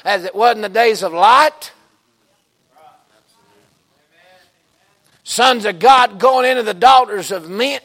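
loud, high, driving sermon delivery; full-force preaching. Real, not acted. Preacher warning the church about the days of Lot, full-force preaching.

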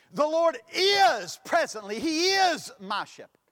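"is" punched high and loud both times; stress on IS. Shown as a demonstration. Preacher shows how emphasis changes meaning, stressing "is". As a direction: lean hard on each "is". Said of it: "you can place emphasis on it".

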